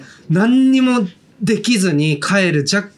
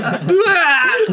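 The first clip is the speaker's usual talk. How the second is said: high drawn-out dying wail; death cry; 11 semitones higher, much slower than usual. Retold or imitated. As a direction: howl like you're being killed. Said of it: "みたいな言って". (断末魔の叫び)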